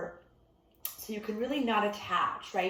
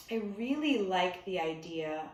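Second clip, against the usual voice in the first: ordinary talk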